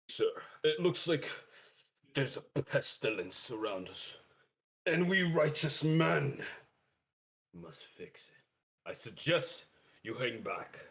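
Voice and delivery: grand, righteous hero voice with dramatic pauses; character voice. Acted. Righteous adventurer warns a man of pestilence, in a put-on character voice. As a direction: put on a declaiming righteous man, pausing for weight. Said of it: "I have to get my voice ready".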